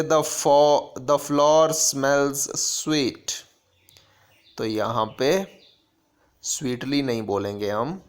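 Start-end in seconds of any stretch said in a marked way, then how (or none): 0.0-3.3 very slow, measured reading of a grammar rule. Shown as a demonstration. Teacher dictating a grammar rule to students, very slow. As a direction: dictate the rule slowly so students can copy it. Reads example sentence.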